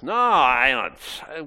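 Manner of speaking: drawn-out, mock-indignant no; indignant denial. Peter denying Jesus to the maid, drawn-out indignant denial. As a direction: stretch the denial out in protest.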